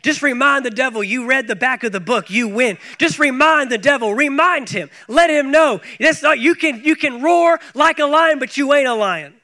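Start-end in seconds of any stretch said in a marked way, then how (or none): 3.0-8.0 rising, louder preacher's call; preaching build-up. Acted. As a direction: drive it home to the congregation.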